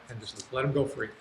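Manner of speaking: normal talking voice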